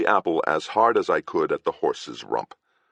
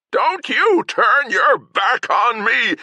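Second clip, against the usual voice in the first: slow, much higher, louder screech; 7 dB louder, slower than usual. Acted. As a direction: shriek it in wild rage. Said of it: "Agravain screeched".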